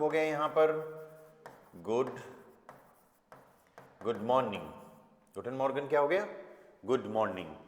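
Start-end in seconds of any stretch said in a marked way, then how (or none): none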